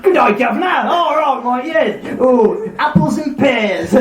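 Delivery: high, hammed-up Cockney patter; mock Cockney. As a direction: put on a badly faked Cockney accent. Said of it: "when I try and do G C C drama".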